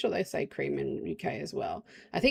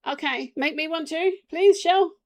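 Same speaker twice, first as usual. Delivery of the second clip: higher, pleading request to a friend; pleading call; 9 semitones higher, 7 dB louder than usual. Real, not acted. Asking Shell on stream for coffee, pleading. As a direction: sweet-talk Shell into making one.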